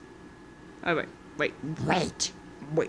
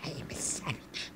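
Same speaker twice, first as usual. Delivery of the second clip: breathy whispered boast; 8 semitones lower, 17 dB quieter than usual. Acted. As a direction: whisper it darkly.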